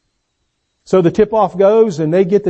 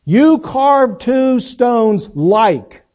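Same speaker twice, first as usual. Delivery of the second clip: very slow and higher, "like" drawn out; slow emphatic scripture; 5 semitones higher, much slower than usual. Shown as a demonstration. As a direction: read "You carve two stones like" slowly and solemnly, leaning on "like". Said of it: "emphasize the word like".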